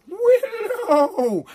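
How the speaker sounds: high, drawn-out mocking call of the name; mocking nickname. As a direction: sing out the nickname like a schoolyard taunt.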